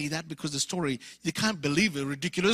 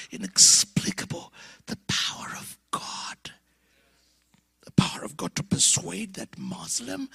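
awed whisper. Real, not acted. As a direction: breathe it in wonder. Awed whisper about the power.

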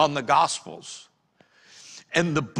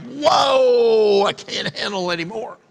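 overwhelmed cry; 4 semitones higher, 3 dB louder than usual. Retold or imitated. Someone drowning in internet information, mock-overwhelmed cry. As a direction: cry out as if swamped. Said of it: "Now it's like".

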